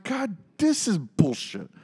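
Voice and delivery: slow, high-pitched whine; indignant griping. Retold or imitated. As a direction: draw out the complaint up high. Acts out indignant complaint.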